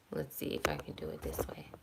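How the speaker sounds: very soft, voiceless mumble to oneself; whispered aside. Real, not acted. Fixing the phone mid-video, whispered aside. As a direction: whisper it privately while working something out. Fiddling with phone, talking to self.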